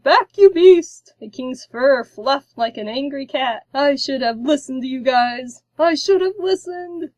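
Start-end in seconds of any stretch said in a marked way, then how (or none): 0.0-1.1 much higher, very loud and slow; shout. A narrator's reading. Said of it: "The king jumped and shouted". 3.7-7.1 regretful. A narrator's reading. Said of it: "The king had regret in his tone".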